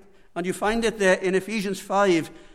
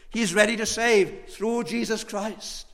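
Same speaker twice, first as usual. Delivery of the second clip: ordinary delivery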